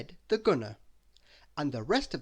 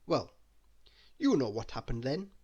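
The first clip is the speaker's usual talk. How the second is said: plain reading voice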